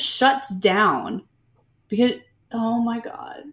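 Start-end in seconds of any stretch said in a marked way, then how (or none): 2.5-3.4 breathless whisper of disbelief; whispered dismay. Real, not acted. Host trailing off appalled at the scene, whispered.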